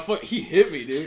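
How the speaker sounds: loud, laughing fake hurt cry; laughing mock pain. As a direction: ham up the fake injury. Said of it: "I was like".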